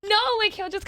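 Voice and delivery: high laughing protest. Real, not acted. Friend laughing at the daddy story, laughing. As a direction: laugh through the words.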